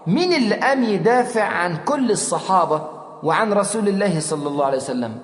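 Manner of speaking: usual speaking voice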